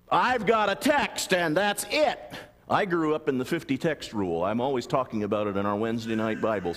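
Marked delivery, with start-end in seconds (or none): 0.1-2.2 high, slow, emphatic declaration; mock dogmatism. Preacher mimicking one-text believers, mock dogmatism. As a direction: stretch it out like an unarguable ruling. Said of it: "Be very careful about".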